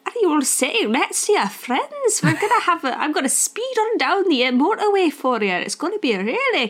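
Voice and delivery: high, lilting Scottish bus driver impression; Scottish accent. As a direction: play a chirpy Scottish coach driver, veering into Irish. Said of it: "He was Scottish".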